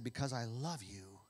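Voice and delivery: soft, low and loving; grandma hugging grandson, softly forgiving; tender grandma. Acted. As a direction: forgive him gently, hushed. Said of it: "she said".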